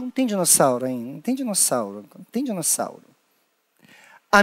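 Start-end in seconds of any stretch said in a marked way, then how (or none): 0.0-3.1 fading out. Real, not acted. Repeats, fading.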